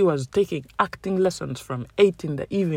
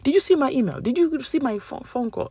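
higher, mock-whiny colleague voice; pestering colleague; 8 semitones higher than usual. Coworker chasing her about unanswered emails, higher mock-nagging voice.

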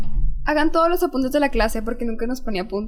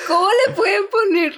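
laughing disbelief; 10 semitones higher, 4 dB louder than usual. Real, not acted. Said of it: "laughing disbelief".

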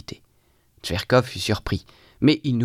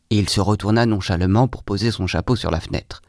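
usual speaking voice

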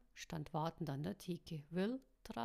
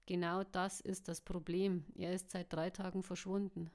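plain reading voice

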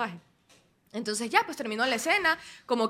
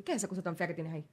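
dismissive director; 5 semitones lower, 9 dB quieter, faster than usual. Retold or imitated. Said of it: "y me dice".

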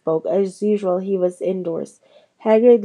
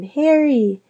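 higher, mildly excited call; excited cry; 6 semitones higher, 4 dB louder than usual. A narrator's reading. Hermione greeting Harry in the hospital wing, excited cry. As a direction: call out the name, pitched up.

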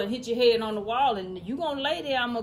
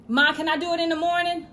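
put-on higher, quick kid voice; loud, imitated child's request; pleading child; 7 semitones higher, 7 dB louder, faster than usual. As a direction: ask mom fast and high, like a kid. Voices a child asking mother.

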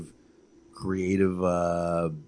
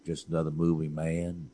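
low, soft, unbothered grumble; half-hearted resigned mutter; Seagal; 3 semitones lower, 5 dB quieter than usual. Retold or imitated. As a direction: shrug it off, lower and quieter. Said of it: "Seagal was like".

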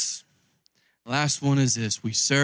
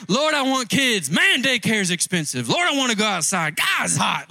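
whiny mock prayer; 7 semitones higher than usual. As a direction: ham up a believer who always wants more. Parodies complaining prayers.

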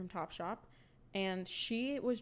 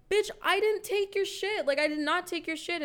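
angry retold yell; 9 semitones higher, 5 dB louder than usual. Retold heated argument, shouting back.

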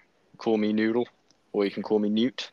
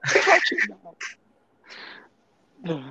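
high mock taunt; 10 semitones higher, slower than usual. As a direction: squeal it like a mocking bully.

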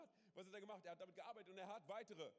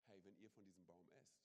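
lower, much softer devilish coaxing; devil's temptation; 10 semitones lower, 9 dB quieter than usual. Retold or imitated. Devil tempts Eve at the tree, low and soft. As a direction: lure them in, low and soft. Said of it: "sagt der Teufel Folgendes".

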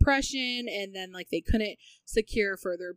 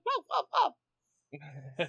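stretched slow whoa, dog voice; slow drawn whoa; 3 semitones lower, much slower than usual. Retold or imitated. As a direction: drag each whoa out lazily. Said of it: "and is like".

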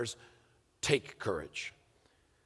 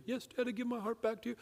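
put-on high, soft, quick child voice; young boy; 9 semitones higher, 8 dB quieter, faster than usual. Son giving his heart back to dad, soft high voice. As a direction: imitate a small boy's meek reply.

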